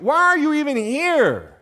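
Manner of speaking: high, loud, exasperated demand; acted big-brother scolding; angry older brother. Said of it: "His older brother's like".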